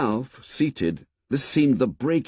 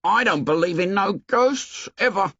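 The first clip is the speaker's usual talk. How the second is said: put-on higher, indignant boy's voice; 10 semitones higher than usual. A narrator's reading. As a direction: scoff at the idea of ghosts. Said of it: "His voice was indignant".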